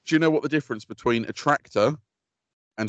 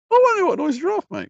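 high playful voice; 4 dB louder than usual. Real, not acted.

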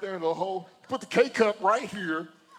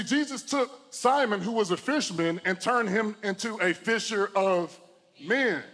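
ordinary talk, nothing marked

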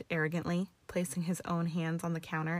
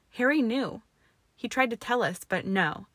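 angry; 4 semitones higher, 5 dB louder than usual. Acted. Hermione's furious outburst.

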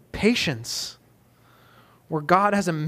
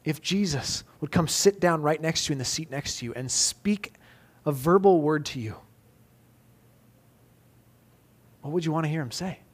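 softer, lower, intimate pastoral tone; soft reflective preaching; 3 semitones lower, 3 dB quieter than usual. Acted. Pastor asks congregation to hear Jesus, soft tender preaching. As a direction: draw the congregation in gently. Quiet reflective close of sermon.

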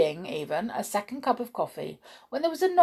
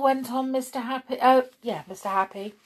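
plain reading voice

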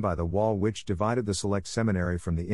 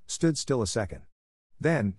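plain reading voice

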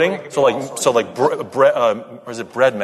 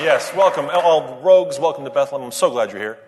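ordinary delivery

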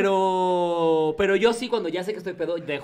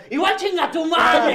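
mock shout; 7 semitones higher, much slower than usual. Acted drunken insult.

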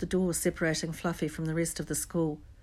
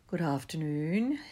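slow, silky greeting; smooth; slower than usual. A narrator's reading. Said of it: "he said smoothly".

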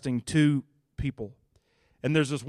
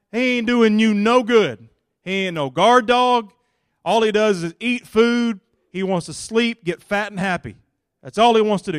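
loud, higher preaching push; preaching at full force; 5 semitones higher, 9 dB louder than usual. Acted. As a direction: build up the congregation, loud.

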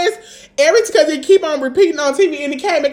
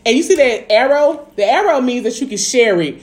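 ordinary talk

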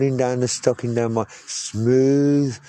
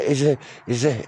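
ordinary talk, nothing marked

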